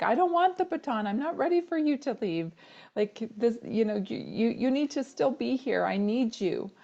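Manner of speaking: high, tearful pleading, voice straining upward; emotional plea. Retold or imitated. Daughter pleading with her mom not to leave, high and tearful. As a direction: beg her not to leave, close to tears.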